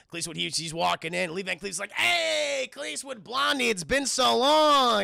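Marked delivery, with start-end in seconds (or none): 1.9-5.0 high, drawn-out, hammed-up hello; hearty greeting. Villain greets Blondie after so long, hammed-up heartiness. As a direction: stretch out a big mock reunion welcome. Villain hails an old acquaintance.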